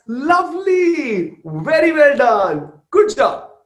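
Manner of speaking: high, loud, drawn-out praise; gushing self-praise. Acted. Mother congratulating herself on relaxing, hammed-up gushing praise.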